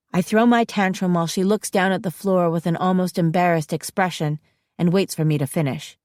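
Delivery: ordinary delivery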